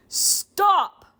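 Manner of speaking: loud, high, forceful order; commanding scream. Acted. Chair demon stopping the professor, commanding scream. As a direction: scream one word with command. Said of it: "screams in a commanding voice".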